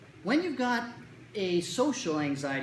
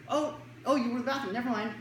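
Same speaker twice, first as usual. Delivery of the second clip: higher, mock-sheepish realization; sheepish kid backing down; 7 semitones higher than usual. Kid learning friend was away, sheepish high retreat. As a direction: retreat lightly in a pitched-up voice. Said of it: "You're like".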